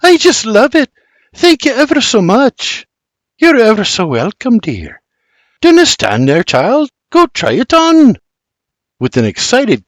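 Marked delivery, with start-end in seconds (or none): 0.0-2.9 put-on high, bright young woman with a Scots lilt; much higher girlish Scots voice; delighted young lass. Daughter thanks mother for dress. 3.4-8.2 higher, fond Highland mother voice; older Scottish woman, higher and louder; Scots mother. Acted.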